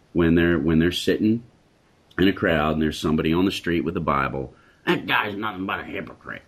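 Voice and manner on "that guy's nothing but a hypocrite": enemy's voice. Retold or imitated. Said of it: "You can just hear the enemy's voice".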